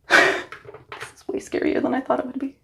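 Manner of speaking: breathy nervous confession; scared whisper. Real, not acted. Alone shaving her head, whispering her fear. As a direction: whisper it, shaken.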